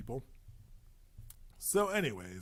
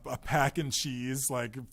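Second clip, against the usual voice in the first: ordinary delivery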